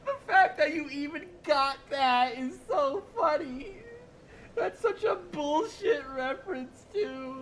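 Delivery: high, loud laughter, close to crying; laughing hard. Real, not acted. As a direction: wheeze through helpless laughter. Laughing hysterically at chat.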